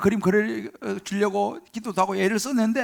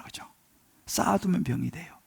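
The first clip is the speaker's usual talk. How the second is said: ordinary talk, nothing marked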